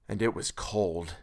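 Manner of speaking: soft, slow and hushed; quiet and slow. A narrator's reading. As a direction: let it drop into a hush. Repeated for effect.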